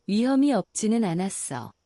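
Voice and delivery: plain reading voice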